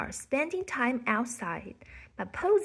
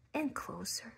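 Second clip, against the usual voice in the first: whispered and much softer; hushed suspense; 8 dB quieter than usual. A narrator's reading. Narrator creeping the bird closer, hushed whisper. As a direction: drop to a tense whisper. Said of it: "narration building suspense".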